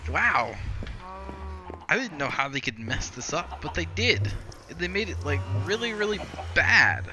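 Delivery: loud, high-pitched retold amazement; stunned disbelief. Gamer recounting how badly they messed up the movie, stunned disbelief. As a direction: exclaim in appalled astonishment, stretching the last word.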